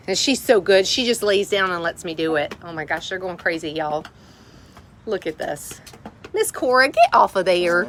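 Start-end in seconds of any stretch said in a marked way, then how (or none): none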